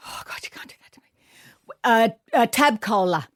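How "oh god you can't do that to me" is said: fast whispered aside. Real, not acted. Guest under quick-fire questions, whispering to herself in dismay. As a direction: breathe it out, quick and hushed.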